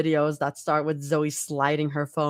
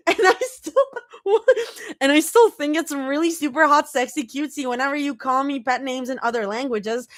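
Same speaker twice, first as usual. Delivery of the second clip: higher, rushed flustered ramble; flustered rambling; 9 semitones higher than usual. A narrator's reading. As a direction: ramble on, embarrassed.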